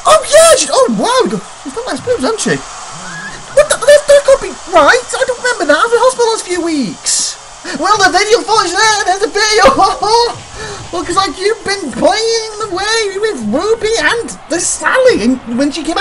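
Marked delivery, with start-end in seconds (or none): 0.0-9.6 stunned. Real, not acted. Shocked by footage.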